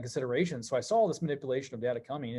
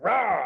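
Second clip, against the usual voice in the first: higher, louder mock lion roar; 5 semitones higher, 6 dB louder than usual. Roaring at the hearing like a lion, loud mock roar. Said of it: "turned me to a lion".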